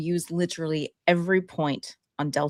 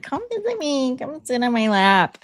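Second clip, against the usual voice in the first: coaxing the cat; 8 semitones higher, 4 dB louder than usual. Retold or imitated. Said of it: "I'm like".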